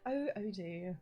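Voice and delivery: slow, imitated let-down text-to-speech voice; disappointed British voice. Streamer imitating the text-to-speech voice at Odie, disappointed. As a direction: mimic a weary British scolding, very slowly. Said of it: "like, a disappointed voice as well".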